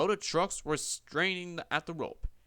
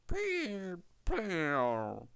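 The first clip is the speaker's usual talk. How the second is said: spluttering out water; 5 semitones higher than usual. A narrator's reading. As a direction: splutter through wet lips. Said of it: "he spluttered".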